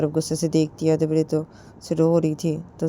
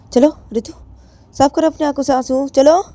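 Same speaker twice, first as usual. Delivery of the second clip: higher, much louder shouted command; angry yell; 10 semitones higher, 9 dB louder than usual. Acted. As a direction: shout at her to wipe her tears. Jiya yells at Ritu.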